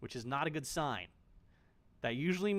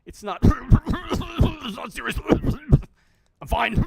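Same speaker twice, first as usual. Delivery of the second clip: slow, loud and higher between mock coughs; hammed-up coughing; 10 semitones higher, 14 dB louder, slower than usual. Streamer acting the infected survivor coughing in his face, hammed up. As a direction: fake a hacking cough as you insist.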